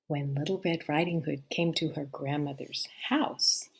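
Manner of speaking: ordinary delivery, nothing marked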